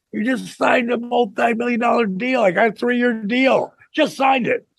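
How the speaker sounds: high-pitched imitated disbelief; incredulous wife. Incredulous wife objecting, retold.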